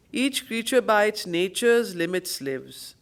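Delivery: deliberate, measured line of verse; poem recitation. Acted. Teacher quoting the poem line, measured recitation. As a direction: give the line its full weight.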